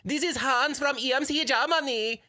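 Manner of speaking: high-pitched mock German phone greeting; hammed-up squeaky German caller; terrible German accent. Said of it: "because I'm gonna butcher this".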